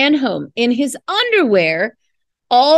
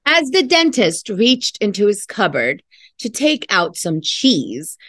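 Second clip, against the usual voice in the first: ordinary delivery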